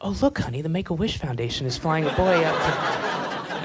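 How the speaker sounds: hushed snooty aside; snobby rich passenger. Retold or imitated. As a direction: whisper it like a gossiping rich lady. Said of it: "somebody's like".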